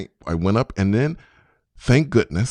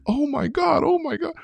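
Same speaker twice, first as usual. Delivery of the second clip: reliving the panic, pitched way up; retold panicked scream. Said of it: "And I started screaming like".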